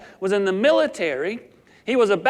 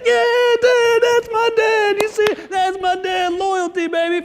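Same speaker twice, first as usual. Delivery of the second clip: much higher, louder gloating; proud inner cheer; 11 semitones higher, 4 dB louder than usual. Retold or imitated. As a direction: brag about your dad to the room. Son's inner pride in dad.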